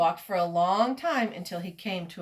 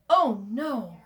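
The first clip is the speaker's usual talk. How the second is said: sharp gasp, then a louder dismayed "oh no"; alarmed gasp; 3 semitones higher, 6 dB louder than usual. A narrator's reading. Reader shows children the peddler waking to trouble, alarmed gasp. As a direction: gasp as if something is suddenly missing. Story's shock at missing caps.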